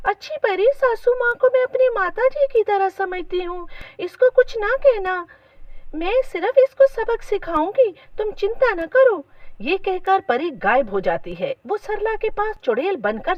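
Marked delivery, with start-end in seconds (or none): 0.1-5.3 put-on high, pleading young wife; Meera. A narrator's reading. Wife begs fairy to spare mother-in-law, high and pleading. 5.9-9.2 high fairy voice, lightly soothing; reassuring fairy. A narrator's reading. Fairy calms the worried wife, high and reassuring.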